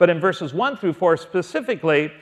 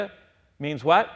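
ordinary talk, nothing marked

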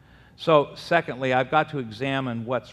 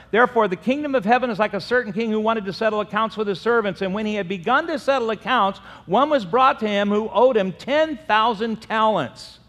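fast, higher, louder reading; scripture reading; 8 semitones higher, 6 dB louder than usual. Acted. Preacher reading Matthew to the church, proclaiming. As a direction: proclaim the parable briskly.

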